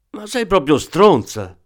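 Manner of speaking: a thief losing his temper at the woman; angry outburst. Acted. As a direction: blurt it out as he jumps up. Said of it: "sbottò scattando in piedi".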